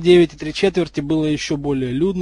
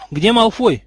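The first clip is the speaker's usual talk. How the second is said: high, loud called-out question; urgent question; 8 semitones higher, 8 dB louder, slower than usual. A narrator's reading. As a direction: demand to know where a friend is. Arrives and asks urgently for friend.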